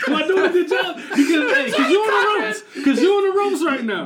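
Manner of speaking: high-pitched, laughing gloating; gleeful taunt. Real, not acted. Debater telling his opponent he is on the ropes, gleeful. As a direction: rub it in loudly through laughter.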